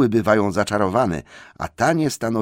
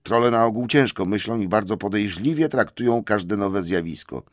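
ordinary delivery

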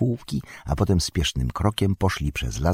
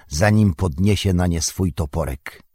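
ordinary delivery, nothing marked

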